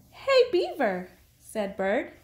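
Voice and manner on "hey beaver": high-pitched call to a friend. A narrator's reading. Bird calls out to Beaver.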